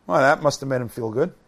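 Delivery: normal talking voice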